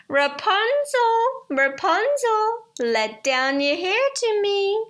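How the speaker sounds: high, loud witch voice calling up the tower. Acted. Enchantress calling up to Rapunzel in the tower, high witchy call. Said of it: "beneath the tower and say".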